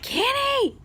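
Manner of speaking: high, urgent shout of the name; panicked call across the house. Retold or imitated. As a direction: call him from the other room. Calling out to her husband.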